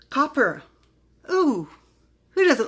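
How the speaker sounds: delighted. Real, not acted. Delighted at next bottle.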